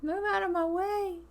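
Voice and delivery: a hammed-up desperate wife voice; voicing wife. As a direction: shove past in a squeaky put-on voice. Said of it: "She's like".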